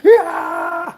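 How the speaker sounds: hammed-up imitation of a screaming rival; mock yell. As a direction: yelp it like a ranting rival. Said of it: "screaming and yelling at the top of his lungs".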